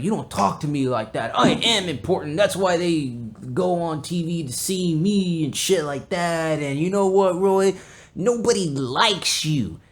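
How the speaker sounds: high-pitched imitation of an indignant coworker; mock self-important rant. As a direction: act out a puffed-up put-down, drawn out. Acts out coworker's insulting rant.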